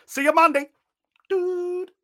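loud, high comic sign-off; cocky acceptance. Acted. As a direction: sign off with a big drawn-out flourish. Basil jubilantly accepts the fight.

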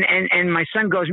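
ordinary delivery

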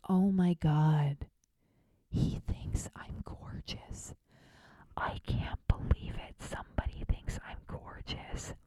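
slow, breathless whisper of disbelief; awed whisper. Retold or imitated. Alone with the compliment, slow awed whisper. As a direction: hush it like a secret too good to be true.

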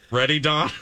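normal talking voice

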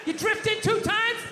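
put-on high, indignant old-school movie tough guy; Dustin Hoffman, De Niro. Comic telling off a stranger who bumped him, old-school movie tough guy. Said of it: "go like old school Dustin Hoffman, De Niro".

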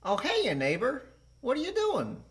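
high-pitched cartoon neighbour; Ned Flanders. Player doing a character clue, high neighbourly voice. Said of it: "Ned Flanders".

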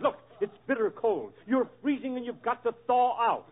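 ordinary delivery

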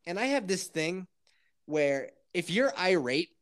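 normal talking voice

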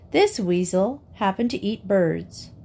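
ordinary delivery, nothing marked

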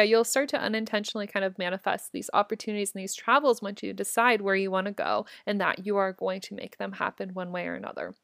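usual speaking voice

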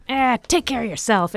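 high, loud brush-off; imitated impatient rebuff; mock exasperation. Snapping back at needy fan.

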